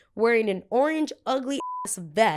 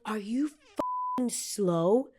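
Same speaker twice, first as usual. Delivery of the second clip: rude security guard; 4 semitones higher, much slower than usual. Retold or imitated. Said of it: "and he's like".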